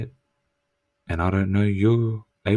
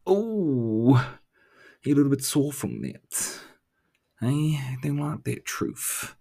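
higher, sing-song mock sympathy; mocking taunt; 5 semitones higher, slower than usual. Needling the viewer about the hard truth, mock pity. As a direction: pretend to pity them to rub it in.

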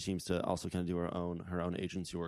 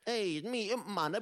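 high cartoon imp voice, far above his own; squeaky little cartoon devil greeting; Impmon impression; 10 semitones higher, slower than usual. Podcast host voicing Impmon for his co-host, high squeaky imp. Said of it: "that was a really good Impmon impression".

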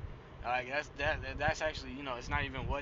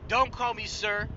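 put-on old soldier snapping; 6 semitones higher, 5 dB louder, slower than usual. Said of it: "this is exactly what he says".

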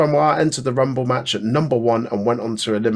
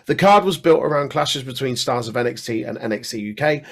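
normal talking voice

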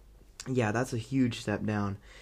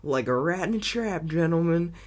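louder, a touch higher hammy delivery; mock villain line; 4 semitones higher, 5 dB louder than usual. Reviewer mocking a cheesy villain line. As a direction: savor it like a smug villain. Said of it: "he's like".

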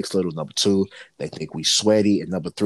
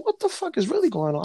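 high incredulous. Retold or imitated. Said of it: "So I said".

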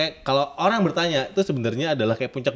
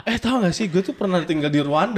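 higher, laughing show-off boast; laughing mock brag; 5 semitones higher than usual. Parodying a braggart, laughing.

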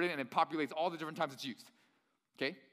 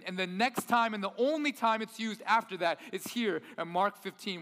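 usual speaking voice

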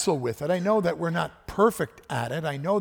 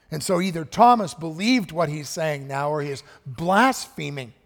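normal talking voice

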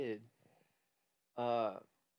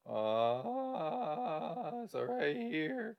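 climbing up to the voice break, slowly; voice break demo; 6 semitones higher, much slower than usual. Shown as a demonstration. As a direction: slide up until the voice cracks. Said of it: "So you go".

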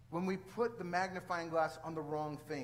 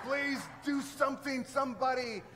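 pleading exasperation; 7 semitones higher, 5 dB louder than usual. Retold or imitated. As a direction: beg anybody to act, at the end of your rope. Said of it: "I'm like".

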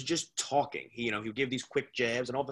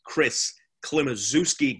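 ringmaster; 4 semitones higher, 10 dB louder than usual. Acted. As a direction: welcome the crowd like a circus ringmaster. Host opens show like announcer.

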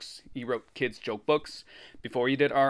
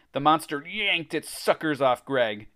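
usual speaking voice

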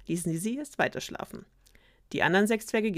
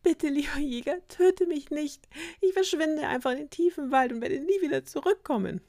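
put-on much higher, pleading little girl voice, close to tears; pleading high girl's voice; 10 semitones higher, 3 dB louder than usual. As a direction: beg for your life in a high child's voice. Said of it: "fing das Schneewittchen bitterlich an zu heulen".

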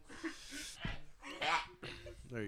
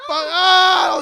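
crying shout of pain, higher; 12 semitones higher, 9 dB louder than usual. Real, not acted. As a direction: yelp as it stings. Pain, warding friend off.

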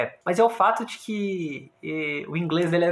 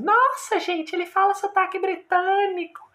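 high, awed gushing voice; impressed listener voice. Retold or imitated. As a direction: gush like an impressed fan. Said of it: "que você fica assim".

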